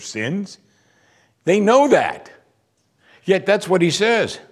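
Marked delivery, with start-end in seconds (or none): 1.4-4.4 higher, louder preaching push. Real, not acted. Preacher driving home the point to the congregation, louder.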